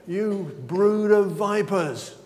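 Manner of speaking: drawn-out, emphatic rebuke; slow mock thunder from John; slow denunciation. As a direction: spell out each word like a prophet condemning them. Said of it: "that's what John's saying".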